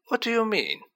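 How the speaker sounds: startled high-pitched squeak; squeaky. A narrator's reading. Headmaster startled by his pupil's hint, squeaking. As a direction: let the voice jump up into a squeak. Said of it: "with a squeak in his voice".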